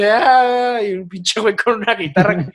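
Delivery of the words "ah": high, loud "ah" yell; loud mock scream; imitated party yell. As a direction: yell "ah" like guys shouting at a party. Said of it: "unos güeyes gritando así".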